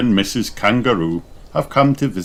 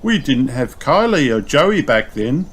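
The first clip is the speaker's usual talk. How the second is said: higher lady kangaroo voice; bright higher mum voice; Missus Kangaroo; 6 semitones higher than usual. A narrator's reading. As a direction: Missus Kangaroo chatting to the pigs. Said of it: "adds Missus Kangaroo".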